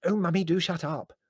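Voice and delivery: high, exasperated hiss at Mummy. A narrator's reading. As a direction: shush her irritably. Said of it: "hissed Prue".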